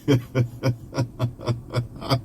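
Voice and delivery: helpless run of chuckles at own slip; long laugh. Real, not acted. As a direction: laugh on and on, softly.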